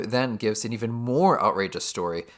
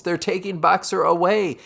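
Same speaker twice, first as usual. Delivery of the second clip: higher, louder cry of alarm; screamed alarm; 8 semitones higher, 7 dB louder than usual. Acted. Benjamin warns the other animals that Boxer is being taken, screamed alarm. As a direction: call out urgently, narrator acting the scream. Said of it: "screams to the other animals".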